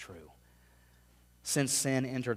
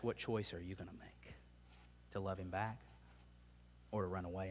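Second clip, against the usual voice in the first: hushed whispered question; a soft whisper to the congregation; 4 semitones lower, 10 dB quieter than usual. Acted. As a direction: lean in and whisper it. Preacher asks audience directly.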